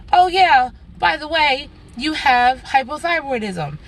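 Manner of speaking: high, breezy, careless news drop; offhand doctor. Retold or imitated. Doctor mockingly imitated dropping a diagnosis, breezy and offhand. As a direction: toss it off like it's nothing.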